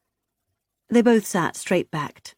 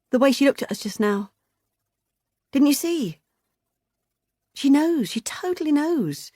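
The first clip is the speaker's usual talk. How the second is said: higher, rushed worried voice; anxious insistence; 9 semitones higher than usual. Acted. Beth insisting Vicky knows, anxious and higher. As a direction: press the worry urgently.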